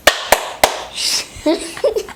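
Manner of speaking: high breathy giggle. Real, not acted. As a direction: burst into giggles. Kid laughs after clip.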